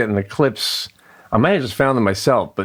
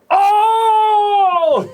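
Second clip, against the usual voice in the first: huge high shrieked Oh, much louder; a kid crowing over a your-mother joke; mocking burn cry; 4 dB louder than usual. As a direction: belt out a gloating kid's Oh as the burn lands. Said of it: "And she goes like that".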